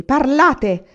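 loud, high, angry order; commanding shout. A narrator's reading. Anania orders the old aunt to talk, furious. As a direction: demand it forcefully. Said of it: "le impose".

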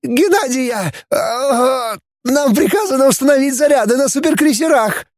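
very high wailing confession; tortured falsetto wail; pained screech. A narrator's reading. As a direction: squeal it out between cries of pain. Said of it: "проверещал Фанас".

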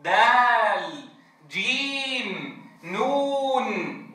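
دال, جيم, نون each held very slow, sung out; teacher modelling full vowel length; long vowel letter names. Shown as a demonstration. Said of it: "والأصل أن يقول دال".

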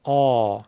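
آ made in the throat, full and heavy; a thick, full-volume آ from the throat; full heavy vowel. Shown as a demonstration. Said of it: "صدای مفخم، صدای تفخیم، یعنی صدای پرحجم". (آ با صدای پرحجم و مفخم از حلق)

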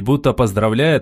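ordinary delivery, nothing marked

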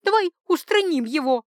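high, loud burst of urging; sudden urgent proposal. A narrator's reading. Duchess urges lover to remove her husband, suddenly urgent. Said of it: "внезапно предложила герцогиня Элджертон".

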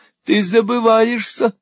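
high-pitched indignant warning; cried in reproof. A narrator's reading. Wife rebuking husband, high indignant cry. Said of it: "вскричала мадам".

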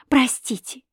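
breathy whispered простите!; whispered plea. A narrator's reading. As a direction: whisper the word простите! pleadingly. Pleading for forgiveness.

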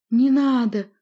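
loud, desperate pleading cry; pleading moan. A narrator's reading. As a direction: beg for mercy, moaning. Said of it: "умоляюще простонал Хнезида".

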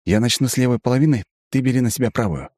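high, quick elf voice; brisk, businesslike elf; Aiven. A narrator's reading. Elf divides the loot.

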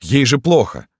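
high, insistent appeal; pleading. A narrator's reading. Yegor tells doctor she is unwell, pleading. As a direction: implore him, pitch lifted. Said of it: "взмолился Егор".

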